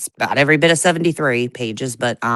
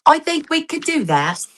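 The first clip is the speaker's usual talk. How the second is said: a light mock British accent, pitched higher; 10 semitones higher than usual. Co-host answering in a mock British accent. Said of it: "like, with a British accent".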